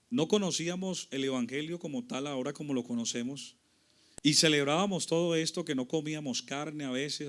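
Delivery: usual speaking voice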